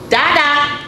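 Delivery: high, loud cat voice; emphatic kitty parent. A narrator's reading. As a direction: call it out high as a coaxing cat. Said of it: "said the kitty cat".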